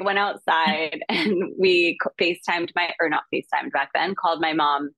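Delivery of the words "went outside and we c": usual speaking voice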